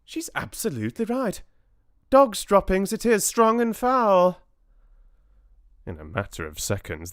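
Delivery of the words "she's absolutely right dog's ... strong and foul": high witch voice growing louder; witch crying out. A narrator's reading. Another witch confirms the foul smell, high cry. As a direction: cry agreement, rising to a loud declaration. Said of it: "cried another voice".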